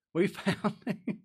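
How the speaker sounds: laughing through the words, drawn out; slow, amused repeat broken by laughter. Real, not acted. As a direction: break into a laugh mid-phrase. Incredulous laugh at disciple's claim.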